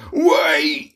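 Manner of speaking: loud cry of wait; cried out. Acted. Troll calling after his departing friend, loud cry. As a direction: call out desperately. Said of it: "he cried".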